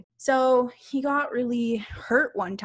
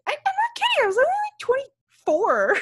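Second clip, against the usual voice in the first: pitched way up, outraged and incredulous; squeaky high protest; 5 dB louder than usual. Retold or imitated. To the doctor, protesting that she was only twenty-four, in a very high squeal. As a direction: shriek it in disbelief. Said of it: "And I was like".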